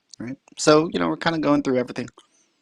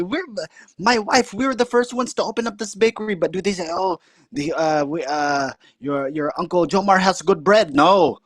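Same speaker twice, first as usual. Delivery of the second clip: indignant uncle; 6 semitones higher than usual. Retold or imitated. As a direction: complain in the uncle's high voice. Uncle voiced in story.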